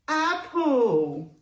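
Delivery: plain reading voice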